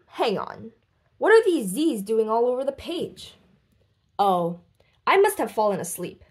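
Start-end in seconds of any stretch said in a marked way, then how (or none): none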